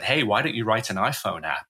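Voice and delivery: ordinary talk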